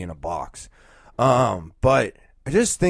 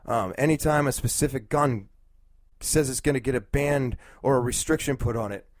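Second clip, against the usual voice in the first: normal talking voice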